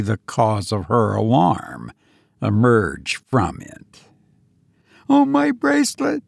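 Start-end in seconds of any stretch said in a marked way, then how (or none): 5.1-6.2 put-on very high girlish wail of dismay; distressed cry. A narrator's reading. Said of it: "she cried in distress".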